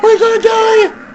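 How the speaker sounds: loud, high panicked yelling; screaming in laughing terror. Real, not acted. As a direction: shout it like you're about to die. Panicked yelling in horror game.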